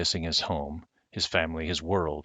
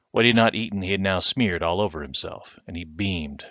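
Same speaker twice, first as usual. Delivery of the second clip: plain reading voice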